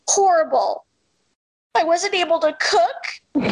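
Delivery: mom's high-pitched blunt complaint; imitating a griping mother, slow and high; mock complaint. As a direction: complain dramatically, pitched well up. Acting out mom's complaint to doctor.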